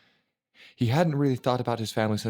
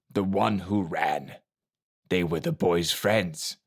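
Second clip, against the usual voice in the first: gravelly master voice; slower than usual. A narrator's reading. Silent Master voice.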